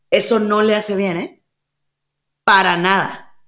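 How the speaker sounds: normal talking voice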